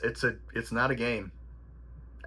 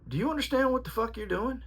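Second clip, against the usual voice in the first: much higher, mock furious yell at the fighter; retold trainer yell; 11 semitones higher than usual. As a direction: replay the trainer screaming, pitched way up. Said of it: "just yelled and screamed and said".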